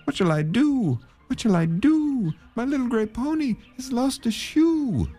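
very high-pitched, plaintive wail; sighing lament. A narrator's reading. Man left standing in the road, high wailing lament. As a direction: wail it high, falling at each end. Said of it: "sighing and saying".

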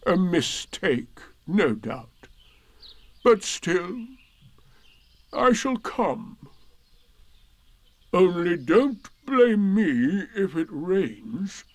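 slow gloomy donkey voice; Eeyore voice. Acted. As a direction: drag it out, gloomy and glum.

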